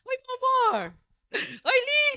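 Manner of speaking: very high, sugary greeting; high-pitched honeyed welcome to a newcomer; sweet-talking pastor. Retold or imitated. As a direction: coo at them to win them over. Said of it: "Mas primeiro eu conquisto".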